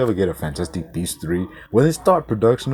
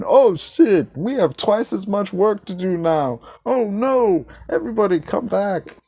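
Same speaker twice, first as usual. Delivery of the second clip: mocking Musk; 10 semitones higher than usual. Imitating Musk after firing half the staff, mock panic, high. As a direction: ham up a boss who's realised his mistake. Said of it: "and going".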